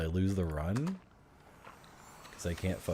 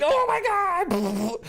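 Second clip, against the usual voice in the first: drawn-out shriek, much higher and louder; panicked shriek; 6 dB louder, much slower than usual. Retold or imitated. Nervous kid spraying bullets at his teammate, shrieking. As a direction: squeal it as if you just shot a friend.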